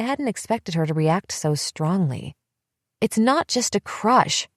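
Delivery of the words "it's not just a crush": mild mock scream, higher and slow. A narrator's reading. Elizabeth screaming at Emily from the passenger seat, furious. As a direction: hurl it at her sister. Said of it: "she screamed at me".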